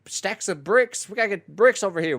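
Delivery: high, loud yokel voice; mock a country worker. Mimicking game NPC worker.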